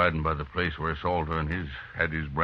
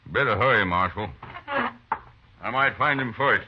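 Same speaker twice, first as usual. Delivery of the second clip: higher, louder challenge; defiant; 6 semitones higher, 3 dB louder than usual. Acted. Lee warning the marshal he'll get Salter, defiant. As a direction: throw it back at the marshal. Angry rancher threatening.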